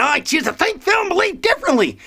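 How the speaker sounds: high, silly declaration; mock defiance. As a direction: proclaim it in a comic high-pitched voice. Repeats statement in silly high voice.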